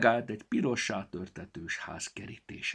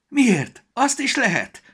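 high, loud eager squeak; perking up; 13 dB louder, slower than usual. A narrator's reading. Storybook getting eager at the shipwreck offer, high and excited. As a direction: brighten with excitement. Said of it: "élénkült fel még jobban".

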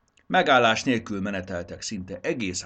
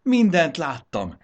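higher, louder joyful cry, slowly; happy shout; 10 semitones higher, 9 dB louder, slower than usual. A narrator's reading. Raxis shouts joyfully to Triceps. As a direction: call out in delight. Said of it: "kiáltotta boldogan Raxis".

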